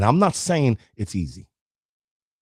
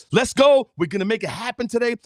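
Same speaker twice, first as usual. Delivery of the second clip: pumped-up coach; 10 semitones higher than usual. Retold or imitated. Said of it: "they go".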